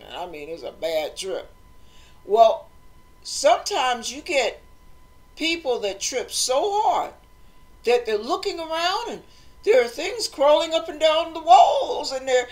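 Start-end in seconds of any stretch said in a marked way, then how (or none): none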